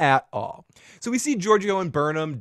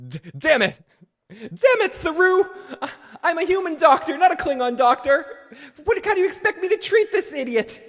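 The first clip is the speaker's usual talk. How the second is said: much higher, fast exasperated doctor voice; frantic doctor; 12 semitones higher than usual. Retold or imitated. Host voices the doctor yelling at Saru, high and frantic. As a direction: squeal it like a panicked doctor.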